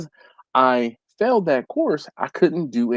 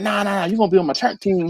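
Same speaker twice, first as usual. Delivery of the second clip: put-on pitched-up Southern coach overruling a kid; retold Southern coach, brushing off objections; 7 semitones higher, faster than usual. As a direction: imitate an insistent Southern coach. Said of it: "he was real Southern".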